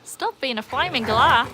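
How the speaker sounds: hammed-up Aussie soap scolding; terrible Australian accent. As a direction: put on a bad, exaggerated Aussie accent. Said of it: "my terrible accent".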